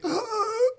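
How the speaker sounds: high strangled gasp; choking gasp. Retold or imitated. Kid choking on mozzarella in a restaurant, choking gasp. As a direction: imitate a desperate choking intake.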